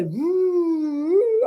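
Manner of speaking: long swooping wind "woo"; wavering, rising-and-falling whoosh of a storm; imitated snowstorm howl. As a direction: howl like winter wind, drawn out.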